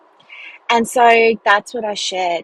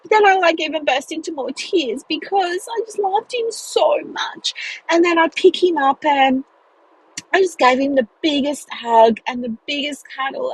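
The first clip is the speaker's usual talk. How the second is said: getting emotional; 7 semitones higher than usual. Real, not acted. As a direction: voice rising high with real emotion, close to tears. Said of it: "I'm getting emotional thinking about it".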